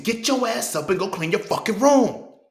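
put-on loud, high, slow yell at the kid; yelling parent, drawn out. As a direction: bellow it like a fed-up parent. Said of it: "you ain't saying".

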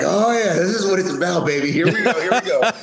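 high, gleeful hype; retold rookie thrill, voice lifted; pumped up. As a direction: psych yourself up for the action. Rookie's thrill at first emergency call.

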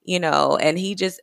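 ordinary delivery

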